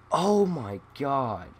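excited. Real, not acted. Excited during a kill streak.

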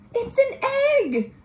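high, surprised it's an egg; delighted reveal for the kids; high thrilled reveal; excited find. Acted. Finding egg under flap.

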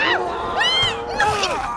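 very high shriek; terrified scream. Real, not acted. Streamer screams as a zombie bites her. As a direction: scream as it grabs you.

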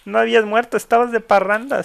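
loud, high, gleeful exclamation; excited teasing. Real, not acted. Streamer teasing the pet believed dead, excited and loud. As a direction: call it out high and elated.

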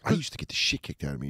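low, fast mock brag; imitating mom. Mom comparing her childhood beatings, low quick mock. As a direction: rattle it off like a tough mom. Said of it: "just being like".

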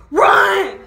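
loud high panicked "run!"; shout. Acted. Vlogger acts out fleeing when a trunk pops, loud panicked yell. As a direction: yell it to escape.

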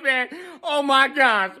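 ordinary talk

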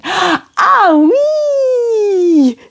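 "Ah, oui" with a gasp, high and keen; eager French yes. Shown as a demonstration. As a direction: gasp then a delighted ah oui. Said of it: "You really agree with something".

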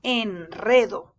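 "Enredo" slowly with a trilled R after the N; strong rolled R. Shown as a demonstration. Teacher showing that R reads strong after N, slow rolled R. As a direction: roll the R firmly in "Enredo". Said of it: "esta R se lee fuerte".

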